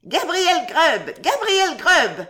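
très aigu, lent, chanté comme un appel; wild shrill chorus. A narrator's reading. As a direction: appel sauvage des lutins, très haut. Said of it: "crièrent de nouveau les voix sauvages". (chœur sauvage et aigu)

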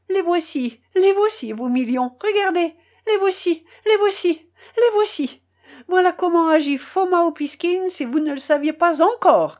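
high, loud, hammered exclamations; triumphant outburst. Acted. Foma scattering banknotes before the colonel, loud and triumphant. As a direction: fling each phrase out in grand defiance.

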